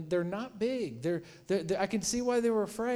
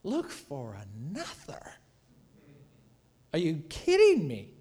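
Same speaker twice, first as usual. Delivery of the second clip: incredulous Jesus. Retold or imitated. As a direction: repeat it back as if it's absurd. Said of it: "Jesus is like".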